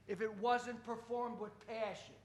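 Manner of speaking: very soft, hushed delivery; hushed emphasis. Real, not acted. Poet tells crowd why performance matters, hushed. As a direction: drop right down for effect. Dramatic drop to make point.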